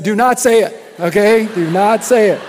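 loud mock protest from the wives; half-joking loud plea to stop; women protesting. The women of the church protesting the joke, loud and mock-indignant. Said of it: "and all God's women said".

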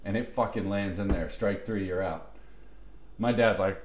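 normal talking voice

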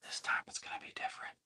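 hushed secret hope, barely audible; inner whisper. Retold or imitated. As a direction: whisper it like a little voice in your ear. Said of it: "kinda whispering in my ear".

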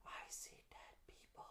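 hushed, eerie whisper; very soft, all breath, quoting a film; whispered movie quote. Acted. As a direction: whisper it like a frightened confession. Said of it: "you think Sixth Sense".

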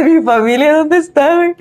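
put-on lost kid. Lost child in Walmart.

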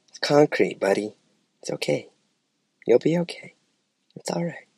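soft, soothing reassurance; gentle correction. Retold or imitated. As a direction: comfort the kid softly.

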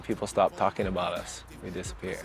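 ordinary delivery

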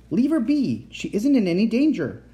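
high, insistent command; ordering. A narrator's reading. Uncle warns the boy off the captive, ordering. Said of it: "Uncle Victor ordered him".

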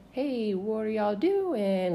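slow, quiet retold hello; softly dragged-out words, imitating the neighbor; drawn-out greeting. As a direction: stretch the greeting out slowly. Neighbor greets them oddly, drawn out.

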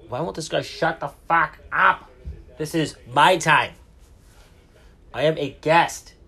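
fed up at being interrupted; exasperated complaint. Real, not acted. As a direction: complain over an intrusive announcement. Annoyed at announcement interrupting recording.

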